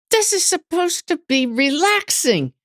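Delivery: high-pitched exasperated protest; irritated complaint. Retold or imitated. Rider finally complaining inside on a rough trail, irritated. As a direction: let the irritation push the pitch way up. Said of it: "getting more and more irritated".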